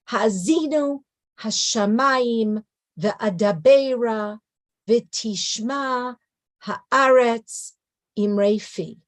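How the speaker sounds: "Ha'azinu, Hashamayim, Ve'adabera, Ve'tishma, Ha'aretz, Imrefi" slowly, one beat per word, pauses between; measured stressed syllables. Shown as a demonstration. Teacher demonstrating biblical meter, "Ha'azinu" onward in slow separate beats. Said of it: "listen to the stress syllables".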